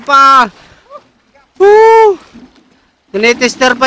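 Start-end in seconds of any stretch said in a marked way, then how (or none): 1.6-2.2 excited cheer. Real, not acted. Cheering on beach.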